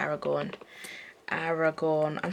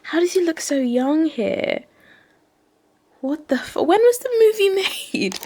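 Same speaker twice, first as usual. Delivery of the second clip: higher and louder, disbelieving; surprised; 10 semitones higher, 7 dB louder than usual. Real, not acted. Vlogger gasping at the actor's youthful photo, incredulous.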